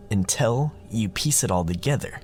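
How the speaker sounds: usual speaking voice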